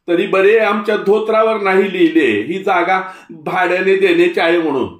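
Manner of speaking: put-on an old man ranting, offended to the core; angry old Tatya.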